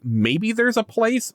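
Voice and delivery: high, slow, drawn-out musing; mock wondering. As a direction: stretch it out high like a hopeful thought. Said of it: "And you're like".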